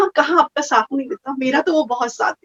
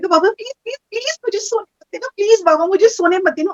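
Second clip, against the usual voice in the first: pleading prayer; 6 semitones higher than usual. Retold or imitated. Retelling how she begged Baba to keep her awake, pleading. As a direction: plead with Baba, a touch desperate. Praying again in hall.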